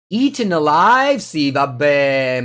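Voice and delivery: high, loud incredulous outburst; loud disbelief. Real, not acted. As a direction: burst out loudly at the absurd move. Incredulous outburst at opponent's card.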